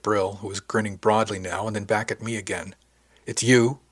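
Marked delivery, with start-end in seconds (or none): 3.3-3.8 louder, sudden realization; exclaimed. A narrator's reading. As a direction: blurt it out in surprise. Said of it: "she finally exclaimed".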